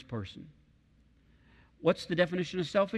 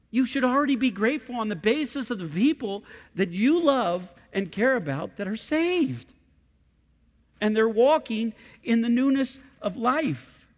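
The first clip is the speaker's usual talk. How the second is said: full-force preaching; 8 semitones higher, 6 dB louder than usual. Acted. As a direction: drive it home to the congregation.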